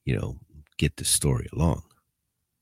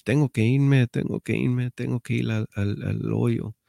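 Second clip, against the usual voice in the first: urgent higher-pitched Spanish plea, acted out; imitating pleading inmate; 8 semitones higher than usual. Inmate begs to go to the hole, mock-pleading. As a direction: plead to get away, voice pitched up. Inmate begging to be moved, retold.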